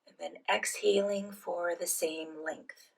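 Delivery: soft, unhurried phrases with long pauses; guided meditation. Real, not acted. Leads guided breathing meditation.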